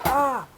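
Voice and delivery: short high loud yelp; mock yelp. Telling the viewers how the hatch smack sounds, mock yelp. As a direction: yelp it like a spanked girl. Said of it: "and she goes".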